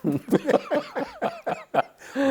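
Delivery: high loud open laugh; real hearty laugh at his own blunder; burst of laughter. Real, not acted. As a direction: laugh out hard. Laughing at the retold joke.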